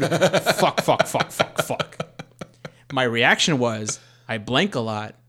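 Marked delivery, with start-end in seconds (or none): 0.0-2.1 hammed-up string of loud curses; mock panicked swearing. He imagines dreading his partner's pregnancy news, mock panicked swearing. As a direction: burst out in pretend panic, fading at the end.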